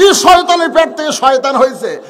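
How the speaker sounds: loud, high outraged yell; retold shouting. Grandfather curses the boy after his ear is soaked, shouting. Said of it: "চিল্লায় বলতেছে".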